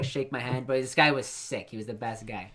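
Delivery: normal talking voice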